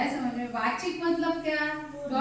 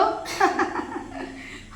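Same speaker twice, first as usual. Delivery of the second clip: demo laugh; 9 semitones higher than usual. Shown as a demonstration. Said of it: "हंसी है तो".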